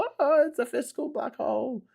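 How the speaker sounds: high mock alarm at the headline. Host reading headlines to viewers, mock alarmed.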